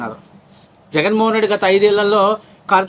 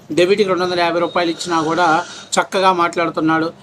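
normal talking voice

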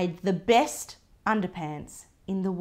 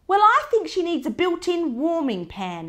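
interrupting; 10 semitones higher, 7 dB louder than usual. Acted. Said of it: "interrupted Gran".

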